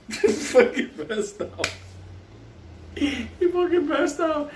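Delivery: slow, high words through laughter; giggling while telling it; laughing. Real, not acted. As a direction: laugh it out slowly. Laughing at friend fainting.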